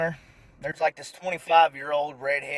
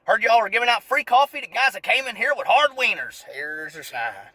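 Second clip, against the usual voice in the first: sarcastic; 6 semitones higher, 4 dB louder than usual. Acted. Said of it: "Bill Engvall moments".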